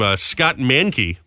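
ordinary talk, nothing marked

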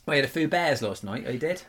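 mock Norfolk chat, flattened vowels; put-on Norfolk pub talk; Norfolk accent. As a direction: do a broad Norfolk local, "few" as "foo". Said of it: "the impression of the Norfolk accent".

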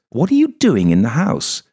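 put-on high, loud, suspicious older woman; wary widow demanding answers. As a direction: challenge the stranger in her house. Suspicious woman voiced in story.

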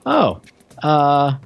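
ordinary talk, nothing marked

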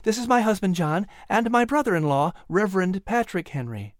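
high, sociable hostess voice; happy introduction. A narrator's reading. Sarah introduces her husband and brother-in-law, happily. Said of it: "Sarah said happily".

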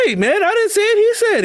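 high laughing shout of denial; laughing protest. Real, not acted. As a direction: laugh while passing the blame.

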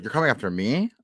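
ordinary talk, nothing marked

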